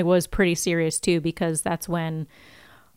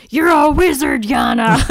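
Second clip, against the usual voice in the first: higher and louder, booming Hagrid; mock Hagrid; 7 semitones higher, 7 dB louder than usual. Hagrid bursts in to tell the uncle he's a wizard, booming mock voice.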